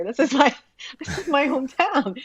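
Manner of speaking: laughing, amused by people's confusion. Retold or imitated. Telling where she's from, laughing.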